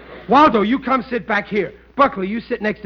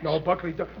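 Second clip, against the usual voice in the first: normal talking voice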